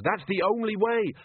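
high-pitched, insistent call; urgent shout. A narrator's reading. Captain insisting on the only way, urgent shout. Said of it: "shouted Jenkins".

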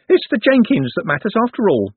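high, anxious artist voice; fretful justification, pitched well up; nervous. A narrator's reading. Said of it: "said the artist nervously".

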